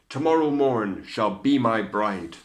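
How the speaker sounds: loud proclaiming prince; cried. A narrator's reading. Prince cries his vow about the slipper. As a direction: proclaim it to the court. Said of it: "he cried".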